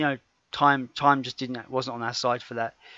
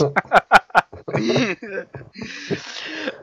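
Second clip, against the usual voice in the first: hearty laugh; 10 semitones higher, 3 dB louder than usual. Real, not acted. Laughing at own impression.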